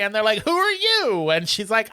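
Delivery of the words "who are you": very high, incredulous question; mock bafflement. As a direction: act out total confusion at a newcomer. Baffled staff question newcomer, retold.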